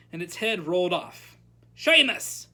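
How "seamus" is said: loud, high roar of a name; teacher's sudden loud call. A narrator's reading. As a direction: roar the pupil's name as a summons. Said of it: "roared Professor Lupin".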